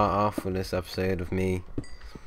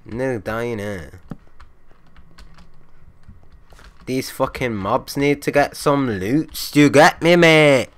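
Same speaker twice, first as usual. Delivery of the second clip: loud, higher, hammed-up Irish accent; mock the mobs in a clumsy Irish accent; bad Irish accent; 5 semitones higher, 7 dB louder, slower than usual. Gamer taunting mobs in a bad loud Irish accent. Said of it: "That's my attempt at the Irish accent".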